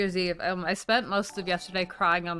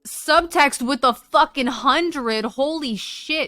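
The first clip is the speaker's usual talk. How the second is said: excited shout; 6 semitones higher, 8 dB louder, slower than usual. Real, not acted. Huge gifted subs, shocked yelling.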